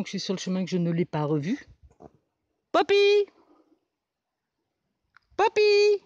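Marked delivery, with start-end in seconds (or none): 2.7-3.3 high loud call across a field; calling a dog. Real, not acted. 5.4-6.0 high loud call across a field; calling a dog. Real, not acted.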